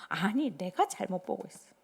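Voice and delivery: ordinary talk, nothing marked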